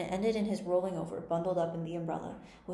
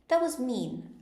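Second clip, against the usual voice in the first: put-on higher-pitched, mildly indignant girl; 6 semitones higher, 4 dB louder, slower than usual. A narrator's reading. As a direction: voice a little girl's mild indignation, pitched up. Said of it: "Alice said indignantly".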